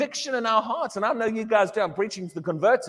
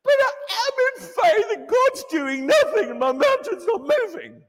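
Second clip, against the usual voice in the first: high, whiny mock complaint; mock complaining believer; 11 semitones higher than usual. Preacher imitating a whiny believer, high and whiny. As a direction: play the self-pitying believer. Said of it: "But they're calling that faith".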